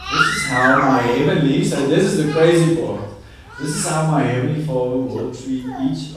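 loud, emphatic pulpit delivery; loud sermon climax; preaching at full force. Acted. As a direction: drive the verse home to the congregation. Preaching the key verse.

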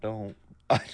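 cutting out mid-word, faint and broken; call dropping out. Real, not acted. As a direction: voice breaking up on a bad call. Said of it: "I cut out".

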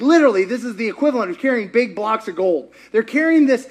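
ordinary talk, nothing marked

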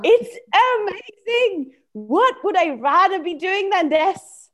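very high, gushing delight; hammed-up high-voiced enthusiasm; exaggerated excited part. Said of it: "you can just exaggerate that".

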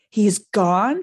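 loud, disbelieving question; alarmed outburst; frantic. A narrator's reading. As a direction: exclaim in sudden dismay. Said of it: "said Harry frantically".

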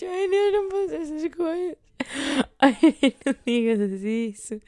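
high, pleading wail, half sobbing; retold crying plea. Pleading with her friend Inês on the phone, high tearful wail. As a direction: beg her friend to stop, voice cracking up high. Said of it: "comecei a chorar".

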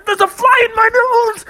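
very high, strained panic; hammed-up freak-out in a squealing pitch; wigging out. Bubby has a fly in his nose, panicking.

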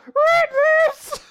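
loud, very high falsetto squeak; squeaky voice. Acted. Sister backing Lance up, loud squeaky voice. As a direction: squeal it like a tiny mouse. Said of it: "squeaky voice".

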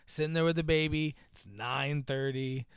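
ordinary delivery

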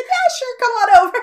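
a laughing, friendly wave-in; laughing invite. Retold or imitated. Felicia Day inviting the sister into the photo, laughing. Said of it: "she just laughed and said".

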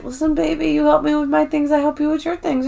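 high, soft imitation of a sweet-talker; creepy smooth talk. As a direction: coo it softly to win her over. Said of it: "he's, like, smooth talking her. Really creepily".

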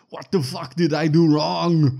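very loud, high, drawn-out hero yell; outraged mock shout. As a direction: bellow it slowly like a wronged action hero. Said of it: "'cause he says".

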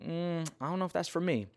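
high-pitched doubtful dodge; mock reluctance. Balking at an unwelcome verse.

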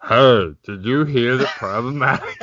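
ad greeting cracking into laughter; mock ad read. Brother retrying the ad voice, breaking up laughing. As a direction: punch the opening loud, then lose it laughing.

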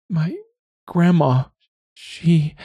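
put-on high, halting girl's voice; a grieving girl who can't finish the sentence; tearful young woman. A narrator's reading.

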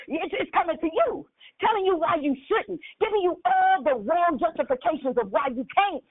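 ordinary talk, nothing marked

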